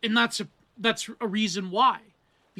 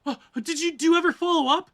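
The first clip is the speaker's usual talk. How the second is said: higher and louder, anxious pressing; anxious Natalie; 8 semitones higher, 5 dB louder, faster than usual. Retold or imitated. Natalie presses Bingham about follow-ups: high, loud, worried. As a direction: push the question up high in worry.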